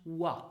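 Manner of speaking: oi said as French wa; wa, not oi. Shown as a demonstration. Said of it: "pronounced as in French".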